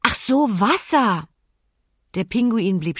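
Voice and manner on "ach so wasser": gedehnter, hoher Aha-Ausruf; plötzliches Begreifen. A narrator's reading. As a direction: langsam und hoch, als ginge ein Licht auf. (erleichtert begreifender Ausruf)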